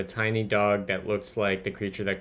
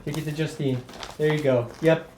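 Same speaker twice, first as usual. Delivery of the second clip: talking to a dog; 5 semitones higher than usual. Real, not acted.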